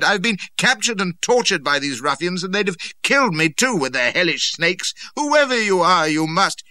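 high, loud torrent of protest; desperate cry. A narrator's reading. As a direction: pour it out loudly until cut off. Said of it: "I cried".

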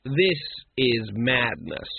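slow, measured, unruffled; calm. A narrator's reading. The patrician interrupts the reading of rights, calm. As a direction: state it coolly, taking your time. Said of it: "said the patrician calmly".

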